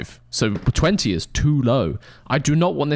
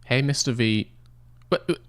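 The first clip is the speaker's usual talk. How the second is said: normal talking voice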